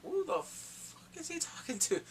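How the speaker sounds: high, slow baffled question; confused high disbelief. Retold or imitated. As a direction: ask it in disbelief. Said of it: "I'm like".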